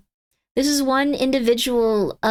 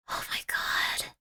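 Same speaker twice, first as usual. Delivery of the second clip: very soft, whispered dismay; overwhelmed whisper; 12 dB quieter, slower than usual. Real, not acted. Streamer overwhelmed by endless bundles, dismayed whisper. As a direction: whisper it to yourself in disbelief.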